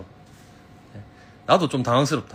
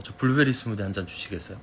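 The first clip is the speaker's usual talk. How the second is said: drama gentleman politeness; 5 semitones lower, 6 dB quieter, faster than usual. Shown as a demonstration. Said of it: "드라마에서 신사 느낌이다".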